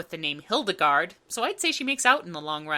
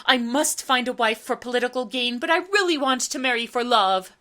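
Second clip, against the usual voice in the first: Prince Charming voice; 4 semitones higher, 4 dB louder than usual. Retold or imitated. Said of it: "the standard Prince Charming dilemma of".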